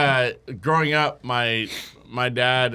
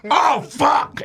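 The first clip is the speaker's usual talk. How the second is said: high mock shout of dismay; imitated cry of alarm; retold panic; 11 semitones higher, 3 dB louder than usual. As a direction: yelp it in comic panic. Old man's eye falls out.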